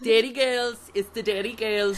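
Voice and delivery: put-on high-pitched, excited Derry accent; hammed-up Derry catchphrase voice; mock Derry accent. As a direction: squeal it in a Northern Irish accent. Breaks into show's catchphrase, imitating accent.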